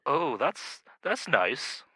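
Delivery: understated passive-aggressive murmur. Retold or imitated. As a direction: lightly imitate a wife's quiet faint praise. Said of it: "she'd say something like".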